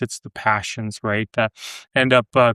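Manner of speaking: ordinary talk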